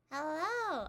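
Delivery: high, loud cheery hello; excited greeting. Real, not acted. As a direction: greet the audience brightly. Greeting stream viewers.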